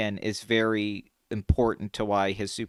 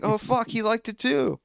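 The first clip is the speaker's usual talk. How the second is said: high, amazed inner exclamation; retold thrilled realization; 11 semitones higher than usual. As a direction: relive the delighted discovery. Retelling thrill at bonding moment.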